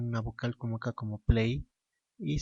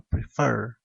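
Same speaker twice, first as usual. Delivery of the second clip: "prefer" louder, weight on the last syllable; stress on second syllable; 6 dB louder than usual. Shown as a demonstration. Teacher explaining the stressed syllable, "prefer" stressed at the end. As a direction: lean hard on -FER in "prefer". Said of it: "la segunda sílaba".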